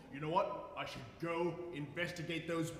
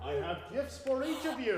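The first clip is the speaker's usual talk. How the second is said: jolly announcement; 8 semitones higher, 5 dB louder than usual. Acted. Father Christmas announcing gifts.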